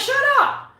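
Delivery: very high mock snap; exasperated shout. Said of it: "I'm like".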